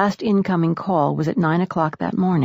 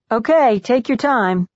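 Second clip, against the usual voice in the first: slow, raised, higher call; unhurried reply that carries to another room; 6 semitones higher, 4 dB louder than usual. A narrator's reading. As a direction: call reassurance through a door. Brother calling through the door.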